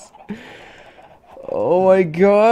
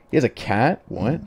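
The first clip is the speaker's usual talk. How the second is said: normal talking voice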